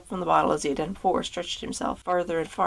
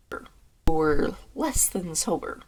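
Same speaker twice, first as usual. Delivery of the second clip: very slow, deliberate re-take; drawn-out slow reading; much slower than usual. Shown as a demonstration. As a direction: stretch every word of less than sober.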